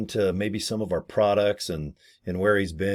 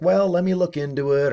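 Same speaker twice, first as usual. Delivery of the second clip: polite brush-off; 8 semitones higher than usual. Retold or imitated. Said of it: "You're like".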